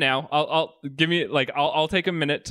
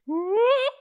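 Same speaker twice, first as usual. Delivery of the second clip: high squeaky whoop; popping sound. Pretend. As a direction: pop it up like a cork. Penis peeking out of trapdoor.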